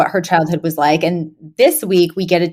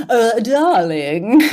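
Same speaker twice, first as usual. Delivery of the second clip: drawn-out, higher posh English "darling"; mock fancy Englishman, very slow; fancy British; 4 semitones higher, much slower than usual. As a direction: put on a stretched upper-crust British accent. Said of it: "what a fancy British person sounds like".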